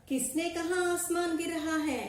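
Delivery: high, angry question from the lion; angry demand. Acted. Lion angrily asks the animals who said it. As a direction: demand who started the rumour. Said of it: "చాలా కోపంగా గట్టిగా అడిగింది".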